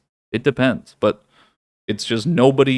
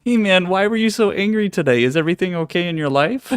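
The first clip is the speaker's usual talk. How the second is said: higher, sing-song mock sympathy; mock concerned voice; 8 semitones higher than usual. Shown as a demonstration. Teasing an angry viewer with mock concern, high sing-song voice. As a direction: pitch it up like fake concern to a viewer. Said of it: "Should we roast more people that D M me?".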